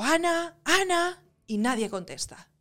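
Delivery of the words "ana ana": higher calling of a name. Retold or imitated. Alone in the flooded flat, calling her flatmate, calling out. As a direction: shout the name into the dark flat. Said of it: "la cuestión que grito".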